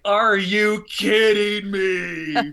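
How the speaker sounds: slow, drawn-out disbelief with a laugh; incredulous. Retold or imitated. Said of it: "I was like".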